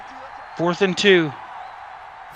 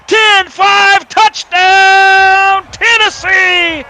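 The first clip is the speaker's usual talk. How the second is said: excited shout; 10 semitones higher, 8 dB louder, slower than usual. Pretend. Mock play-by-play touchdown call.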